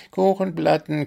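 high group chant; chorus calling. A narrator's reading. The animal chorus calling out to the gnome, chanting. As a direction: call it out like a crowd of animals. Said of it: "der bunte Chor hinter ihm rief".

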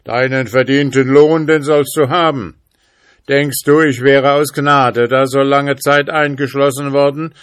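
high, loud, relentless proclamation; fearsome spirit calling. A narrator's reading. Spirit Mercurius sentences the schoolboy, loud thundering threat. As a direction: declaim like a mighty vengeful spirit. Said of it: "rief der Geist".

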